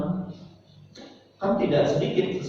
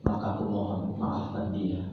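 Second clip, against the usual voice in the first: quiet prayer; 6 semitones lower, 4 dB quieter than usual. Shown as a demonstration. Teacher demonstrates forgiving prayer for a student, soft and humble. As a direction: pray softly as if alone with God. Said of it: "ngomongnya begini".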